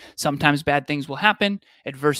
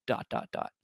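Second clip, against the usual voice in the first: whispered ellipsis; 9 dB quieter than usual. Shown as a demonstration. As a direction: whisper "dot, dot, dot" trailing off. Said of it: "trailing ellipsis spoken".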